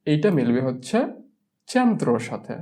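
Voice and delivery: usual speaking voice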